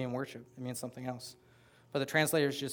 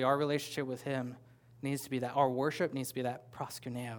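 normal talking voice

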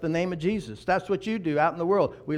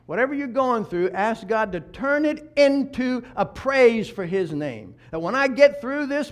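impassioned preaching; 5 semitones higher, 8 dB louder than usual. Real, not acted. Preacher urging the congregation to turn trouble into praise, fervently. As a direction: preach with building force, louder and higher.

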